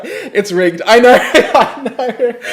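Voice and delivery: high, loud and laughing. Real, not acted. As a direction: laugh it off, slowing down. Laughing at the quiz result.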